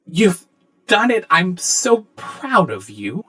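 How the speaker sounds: high, gushing praise, slowing at the end; Anna. Acted. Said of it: "Anna says".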